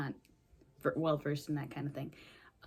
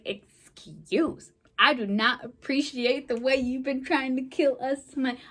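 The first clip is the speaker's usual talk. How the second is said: higher, louder indignant telling-off; mock indignation; 6 semitones higher, 5 dB louder than usual. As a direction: act out an affronted scolding. Voices Joel confronting his attackers.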